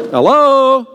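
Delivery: high, loud, comic call from outside; comic hello. Pretend. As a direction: call through the door, still stuck outside. Peter left knocking outside.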